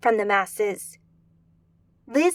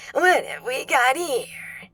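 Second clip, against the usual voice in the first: slow, rasping demon voice; rough voice of a heavy smoker; scratchy smoker voice; slower than usual. A narrator's reading. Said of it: "its voice scratchy, like a forty-a-day smoker".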